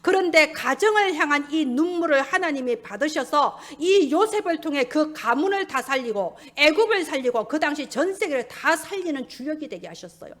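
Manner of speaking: ordinary talk, nothing marked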